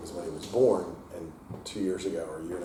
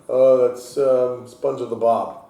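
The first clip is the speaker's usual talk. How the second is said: jokey deadpan; 5 semitones higher, 10 dB louder, much slower than usual. Retold or imitated. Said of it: "I'm like".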